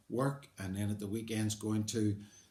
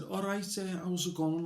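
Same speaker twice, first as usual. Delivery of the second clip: put-on Liverpool accent, pitched higher; 8 semitones higher than usual. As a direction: do a higher-voiced Scouse accent for a job interview. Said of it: "I put on a Liverpool accent".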